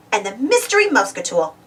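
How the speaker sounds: ordinary delivery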